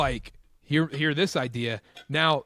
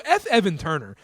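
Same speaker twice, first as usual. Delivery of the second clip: usual speaking voice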